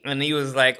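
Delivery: ordinary delivery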